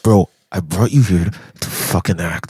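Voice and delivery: mock Ryan Coogler. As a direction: scold the actor like a fed-up director, low and slow. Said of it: "I know he's like".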